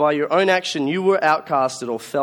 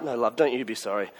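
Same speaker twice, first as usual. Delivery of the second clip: soothing mum; 5 semitones lower, 5 dB quieter than usual. Retold or imitated. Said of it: "Mum's like".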